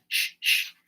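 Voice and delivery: sharp unvoiced "shh" twice; shushing. A narrator's reading. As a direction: hush someone with two hissed shushes. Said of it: "the Hatter and the March Hare went".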